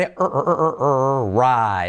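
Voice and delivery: "r, r, r, r" as repeated short English R sounds; isolated R sound. Shown as a demonstration. Teacher drilling the key letter R, repeated isolated sound. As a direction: drill the R alone, pulse by pulse. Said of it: "letra clave R".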